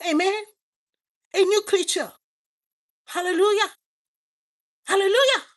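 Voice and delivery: high, loud shout of praise; loud hallelujah call. Real, not acted. Preacher calling the church to praise, loud and high. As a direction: lift the congregation with a shout.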